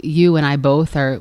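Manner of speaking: ordinary talk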